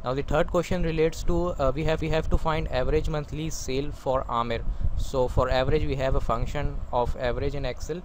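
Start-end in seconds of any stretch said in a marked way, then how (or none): none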